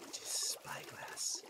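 breathy unimpressed whisper; flat whisper. Real, not acted. Unimpressed at the gift.

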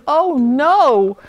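high, loud aghast cry; gasped horror. A narrator's reading. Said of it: "gasped Bartholomew".

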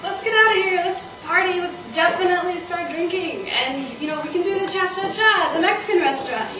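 high, eager party pitch; forced enthusiasm. Acted. Woman coaxing her date to go drinking, eagerly upbeat. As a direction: talk up the night with lifted, lively pitch.

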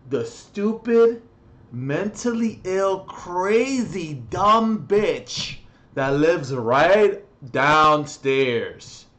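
furious, spitting out each insult slowly; slow angry insults; angry rant. Real, not acted. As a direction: rage at the neighbour. Ranting about downstairs neighbour.

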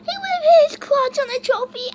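high, loud disbelieving outcry; shocked cry. Real, not acted. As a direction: blurt it out in shock, voice cracking high.

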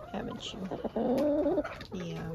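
drawn out, tender pet talk; cooing to a pet. Real, not acted.

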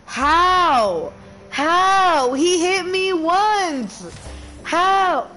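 high, loud, furious disbelief; raging gamer. Real, not acted. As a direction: yell it at the screen, outraged. Rage after dying in game.

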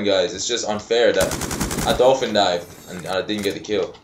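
ordinary talk, nothing marked

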